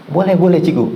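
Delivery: high and loud, keen agreement; eager approval. Retold or imitated. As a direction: cheer the idea on. Class eagerly approves the name. (setuju dengan semangat)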